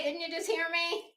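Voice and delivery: ordinary delivery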